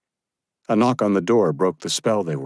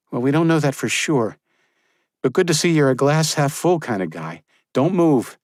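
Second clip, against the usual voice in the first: higher, firm woman's voice; young woman's voice; 9 semitones higher, 4 dB louder than usual. Acted. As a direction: give a brisk order. Josephine teasing then ordering.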